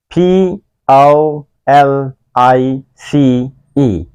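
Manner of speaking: slow letter-by-letter spelling. Shown as a demonstration. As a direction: spell each letter slowly. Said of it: "spelling out the word". (धीरे-धीरे अक्षर बोलना)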